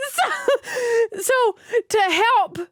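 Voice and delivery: high voice, still helpless with laughter; slow, squeaky words after a laughing fit. Real, not acted. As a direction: try to resume through the giggles.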